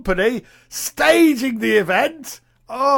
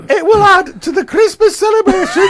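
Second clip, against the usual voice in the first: high voice; 9 semitones higher than usual. Retold or imitated. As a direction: read it in a silly high voice. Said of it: "because I like that voice".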